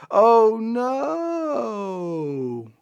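long drawn-out, falling "no"; hammed-up "oh no"; mock dismay. Sarcastic horror at a welcome demand.

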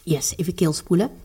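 talking between sips of water; quiet, mid-drink, rinsing throat; while sipping a drink. Real, not acted. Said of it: "Ik ga even een slokje drinken".

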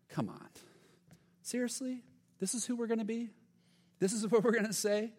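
high, incredulous sarcasm; sarcastic. Acted. James mocks believers who ignore the hungry, sarcastic. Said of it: "you catch almost the sarcastic tone".

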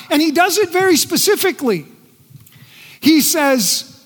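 ordinary delivery

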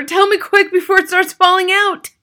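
high, loud panic; father wailing. A narrator's reading. Father begs for a quick remedy, wailing. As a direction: plead urgently in a wail. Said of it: "wailed the father".

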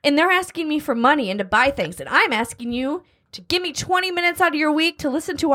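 high, pushy sales pitch; upbeat promo plea. Real, not acted. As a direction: hype up the listeners.

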